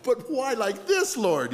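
high, slow protest falling away; comically wounded plea; mock plaintive whine. Embarrassed preacher questioning the Lord, mock plaintive whine. Acted complaint to God.

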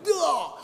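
high exclaimed groan of dismay; comic cry of mortification; mock anguished groan. Preacher retelling being seen unzipped, mock anguished groan.

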